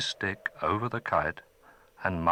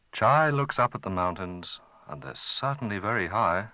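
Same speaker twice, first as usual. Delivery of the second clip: ordinary delivery, nothing marked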